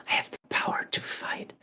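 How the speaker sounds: whispered mock-epic self-discovery; awed whisper. Heroine after killing the small-fry enemy, awed whisper. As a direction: breathe it out in a dramatic whisper.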